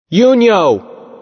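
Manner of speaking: loud, high call across a field. Acted. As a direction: shout the name to be heard. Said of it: "I called out".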